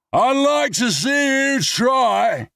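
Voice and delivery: high, slow, loud slurred taunt; drunken yell. Acted. Drunk man yells after Brad stomping off, high mocking taunt. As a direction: holler it after him mockingly. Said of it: "I yell to his back".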